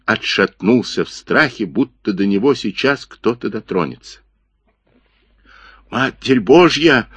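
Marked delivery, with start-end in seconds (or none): 5.9-7.1 gasping fear. A narrator's reading. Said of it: "задыхаясь, прошептал Джипа".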